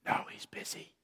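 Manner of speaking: breathy, voiceless little whisper; child's whisper. A narrator's reading. Child telling the boss on the phone, whispering. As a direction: whisper it so nobody hears. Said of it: "whispered the child".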